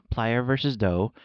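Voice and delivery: ordinary delivery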